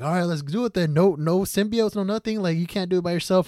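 high-pitched taunt, egging him on; imitated dare, voice pushed up high; goading. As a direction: goad him into a fight. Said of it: "Goads him into it".

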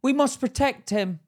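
high, slow hammed-up pleading; mock overprotectiveness. Mocking people who shield kids from pain, high drawn-out plea.

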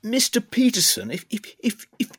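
[name] asks Peterson politely as Rees-Mogg, high and slow; Rees-Mogg impression. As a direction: ham up a fussy, courteous Rees-Mogg. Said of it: "Jacob Rees-Mogg".

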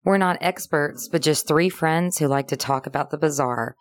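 usual speaking voice

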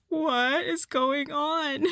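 high, slow, bewildered cry with a laugh. Retold or imitated. New boy baffled by the cursing principal, high bewildered cry. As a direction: whimper it out, lost and baffled. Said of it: "he's just like".

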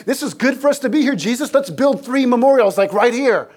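mock overeager disciple blurting it out. Disciple interrupting a holy moment, blurting it out eagerly. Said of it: "Peter blurts out".

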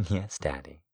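quiet, soft reply; soft eager whisper. Acted. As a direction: answer softly, eager. Her quiet answer.

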